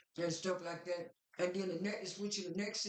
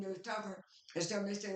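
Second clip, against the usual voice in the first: ordinary delivery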